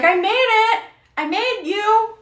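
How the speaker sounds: high, loud, slow mock cry of triumph; mock proud creator. Mock creator crowing to the baby she made. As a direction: crow it like a proud careless maker.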